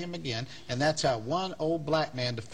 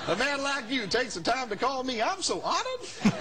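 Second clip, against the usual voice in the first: very high, hammed-up reverend voice; imitated honored reverend; 9 semitones higher than usual. Reverend thanks his threatening caller, high hammed-up voice. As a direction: gush it high, stretching the end. Reverend's unfazed reply on the phone.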